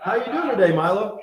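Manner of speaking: high, loud pet greeting; imitated chirpy hello to a pet; talking to cat. Greeting the cat in retold exchange.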